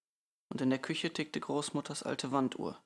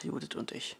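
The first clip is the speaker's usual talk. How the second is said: usual speaking voice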